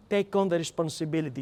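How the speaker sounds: normal talking voice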